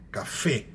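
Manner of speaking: café with the weight on final fé; stress on last syllable. Shown as a demonstration. Teacher correcting the example, "café" stressed on fé. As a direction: lean on the fé of "café". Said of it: "A última sílaba que é a tônica".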